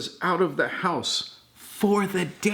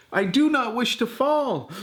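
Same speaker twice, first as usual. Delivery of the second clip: panicked fish; 8 semitones higher than usual. A narrator's reading. Grandpa voicing the dangling fish to grandchild, high and panicked. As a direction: squeal it out like a scared fish.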